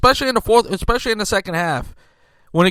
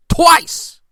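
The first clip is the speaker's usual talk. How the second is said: twice yelled high and loud; the word twice shouted in disbelief; 8 semitones higher, 6 dB louder than usual. Real, not acted. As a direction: throw twice out as an outraged shout. Outraged at the repeat collapse.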